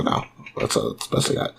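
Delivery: usual speaking voice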